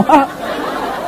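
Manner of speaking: soft airy chuckle; amused soft laugh; breathy laugh. Real, not acted. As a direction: laugh quietly under the breath. Laughing at own joke.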